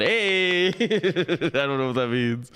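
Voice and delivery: squeaky yelp into a laugh; high goofy shout then laughing. Real, not acted.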